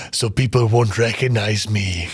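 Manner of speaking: low, slow menacing villain; villain Kurgan voice. Retold or imitated. Retelling the Kurgan's disguise line to friends, low slow villain voice. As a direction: growl it out like a smug movie villain. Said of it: "and he goes".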